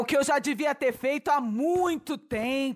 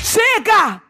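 sharp angry stop; 9 semitones higher, 7 dB louder than usual. Acted. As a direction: bark it higher and louder. Mother cuts daughter off.

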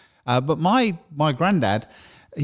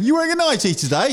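high teasing question to a stranger; nosy passer-by; 13 semitones higher than usual. Retold or imitated. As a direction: call it out like a cheeky passer-by. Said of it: "Camden going".

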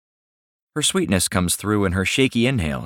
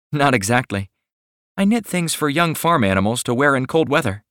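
higher, sweet shy woman's voice; shy young woman; 6 semitones higher than usual. Acted. Millie speaking again.

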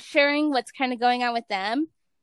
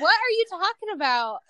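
higher, playful indignation; mock indignant self; 7 semitones higher than usual. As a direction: act out your own disbelief. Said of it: "I'm like".

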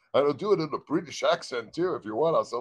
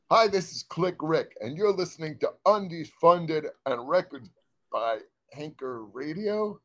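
radio announcer; 4 semitones higher than usual. Acted. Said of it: "another take of radio ID".

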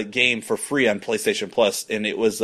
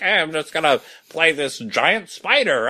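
nerdy card player voice; 4 semitones higher than usual. Retold or imitated. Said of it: "They all talk like that, too".

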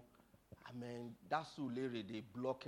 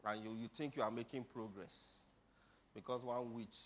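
usual speaking voice

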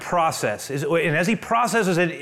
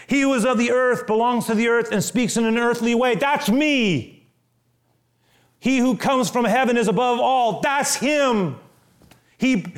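raised, ringing pulpit delivery; preaching scripture; 6 semitones higher than usual. Acted. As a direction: proclaim it to the congregation. Preaching scripture with rising force.

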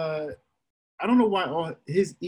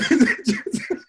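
airy unvoiced laugh; breathy laugh; 7 semitones higher than usual. Real, not acted. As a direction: huff out a laugh. Laugh at own extremity.

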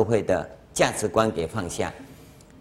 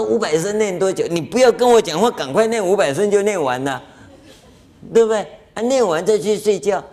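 exasperated master; 7 semitones higher than usual. Retold or imitated. Master telling the student to stop talking, high and exasperated. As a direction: shoo him off to finish quickly.

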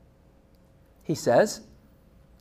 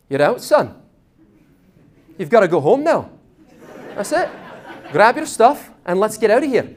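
dad calling it; 4 semitones higher, 6 dB louder than usual. Retold or imitated. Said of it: "stands there and goes".

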